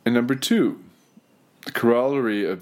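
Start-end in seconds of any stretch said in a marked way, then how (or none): none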